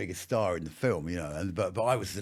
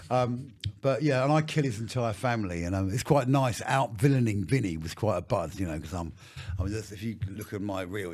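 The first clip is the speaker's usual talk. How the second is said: ordinary delivery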